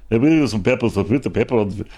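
lightly put-on Italian waiter accent; botched Italian accent. Waiter serving pepper to a diner, botched Italian accent. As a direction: do a poor Italian accent, drifting toward Polish. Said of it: "That's not an Italian accent".

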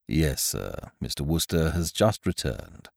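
a hint of a low, soft, deferential valet; lightly voiced butler, quiet and proper; Jeeves the butler. A narrator's reading. Butler answering his master, low and deferential.

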